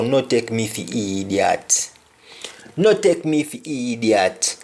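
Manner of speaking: "No take me for idiot" in a Jamaican Patois lilt, "for" as "fa"; a touch of Patois indignation. Shown as a demonstration. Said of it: "an example like".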